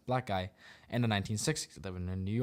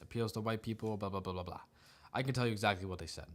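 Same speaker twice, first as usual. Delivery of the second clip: usual speaking voice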